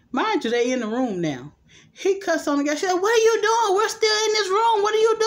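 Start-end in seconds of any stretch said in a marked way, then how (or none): 2.9-5.2 high, loud retold scolding; exasperated yelling. Woman scolding the man who cussed on the gas, exasperated yelling. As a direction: yell it in high-pitched disbelief.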